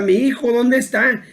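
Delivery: mild mock yell; father's mock shout. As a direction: imitate a searching father's cry without real volume. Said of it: "Y el papá grita".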